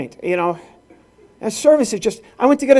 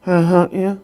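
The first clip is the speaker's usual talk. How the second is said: imitated clerk's garbled grunt; mock counter-clerk mumble; unintelligible mumble. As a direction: garble the words past understanding. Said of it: "she did the same thing".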